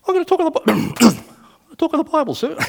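high squeaky nervous voice, with a cough; comically strangled, high-pitched nerves, breaking off to cough. Retold or imitated. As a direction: imitate a terrified soldier squeaking out his answer. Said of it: "I said it very nervously".

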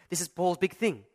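ordinary delivery

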